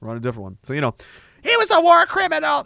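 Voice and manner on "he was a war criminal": very high put-on whine; mocking outraged critic. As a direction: parody someone scandalised, high-pitched.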